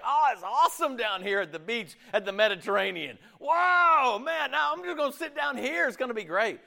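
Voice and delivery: hammed-up delight at the Mediterranean; carefree vacation voice. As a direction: gush like someone lounging on holiday. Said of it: "He's like".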